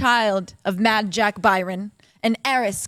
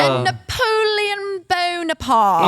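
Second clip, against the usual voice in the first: dramatic reveal; 6 semitones higher, slower than usual. Acted.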